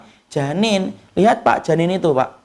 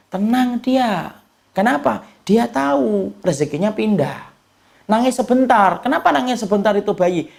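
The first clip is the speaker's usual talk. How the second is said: usual speaking voice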